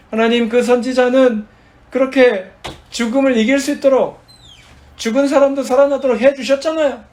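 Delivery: loud, high, insistent prayer of protest; arguing with God. Shown as a demonstration. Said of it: "하나님께 따지시는 거예요".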